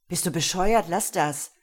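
loud, sharp outburst; angry hiss. A narrator's reading. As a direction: lash out in irritation, voiced and forceful. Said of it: "zischte er". (wütend zischend, laut)

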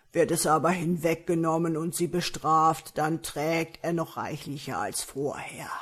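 loud, growly ogre voice; growling man-eater. A narrator's reading. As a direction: reveal the secret in an ogre's growl. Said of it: "knurrte der Menschenfresser". (knurrende, laute Menschenfresserstimme)

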